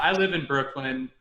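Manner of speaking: ordinary talk, nothing marked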